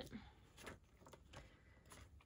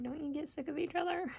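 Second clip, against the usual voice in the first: dad quote; 5 semitones higher, faster than usual. Retold or imitated. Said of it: "he's like".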